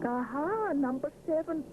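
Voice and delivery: proud announcement. A narrator's reading. Mister Sponge finding the handle, proudly announcing. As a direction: show off the answer. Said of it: "he announces proudly".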